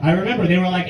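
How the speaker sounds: ordinary talk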